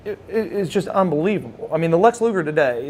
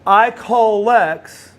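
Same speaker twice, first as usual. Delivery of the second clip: usual speaking voice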